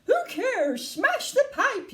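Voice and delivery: high, loud, drawn-out yell; frantic high-pitched command. A narrator's reading. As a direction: order it smashed at full pitch. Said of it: "yelled Missus Gloop".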